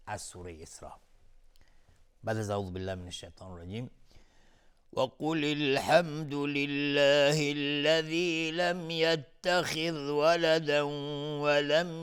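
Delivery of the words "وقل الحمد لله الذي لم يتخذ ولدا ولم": slow, high, drawn-out tajwid chant; Quran recitation. Acted. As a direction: chant it slowly like a qari. Quran recitation after ta'awwudh. (تلاوت قرآن با صوت کشیده و آهسته)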